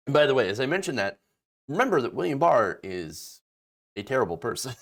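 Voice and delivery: usual speaking voice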